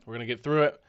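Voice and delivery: talking with a blocked nose; nasal, stuffed-up cold voice; stuffy nose. Real, not acted. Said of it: "I do sound, like, a little stuffy".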